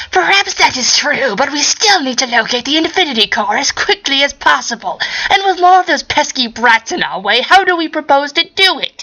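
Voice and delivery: high, strained mad-scientist voice; scheming scientist squeezed high in the throat; Hal G voice. Acted. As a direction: push the voice up into a wheedling villain. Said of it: "doing Hal G's voice hurts".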